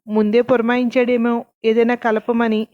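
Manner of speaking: plain reading voice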